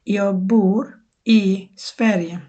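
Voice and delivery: Swedish said slowly, word by word; slow model phrase. Shown as a demonstration. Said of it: "তখন আমরা বলবো". (ধীরে ধীরে উচ্চারণ)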